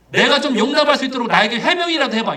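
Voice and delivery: high, insistent mock demand; demanding an explanation. Father demanding his son explain himself, high and insistent. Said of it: "이렇게 하지도 않았어요".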